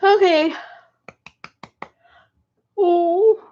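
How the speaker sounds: yawning mid-word; "Oh" said through a big yawn. Real, not acted. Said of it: "I'm actually yawning".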